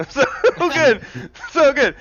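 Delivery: high and loud, laughing it out; delighted laughing praise. Real, not acted. GM cackling at the player's roll of one, gleeful. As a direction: crow with glee at the bad roll.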